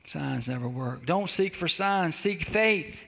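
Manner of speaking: ordinary delivery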